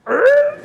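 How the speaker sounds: short high mechanical growl; imitated machine noise. Engineer kills the air conditioning, imitated high mechanical noise. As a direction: mimic machinery jolting with a loud, high noise. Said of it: "all you hear is like".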